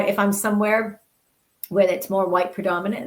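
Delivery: ordinary delivery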